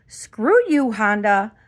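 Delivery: indignant venting with punched words; so mad. Real, not acted. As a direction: tell the company off, heated. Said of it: "I am so mad".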